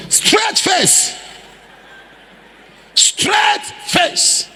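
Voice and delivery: high, amazed exclamation. Real, not acted. Preacher marvelling at the lying boy, amazed. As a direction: marvel at the nerve. Mock-marvelling at boy's lie.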